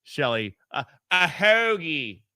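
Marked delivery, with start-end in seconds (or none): 1.1-2.2 "hoagie" drawn out slowly in a Philly accent, like "heggie". Shown as a demonstration. Radio host teasing caller Shelly, saying it in a Philly accent. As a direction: say "hoagie" the Philly way, slow and stretched. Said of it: "You gotta say it with a Philly accent".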